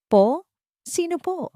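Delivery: high, startled question; a young maid's bewildered reply; confused surprise. Acted. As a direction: caught off guard, asking back. Confused maid answers.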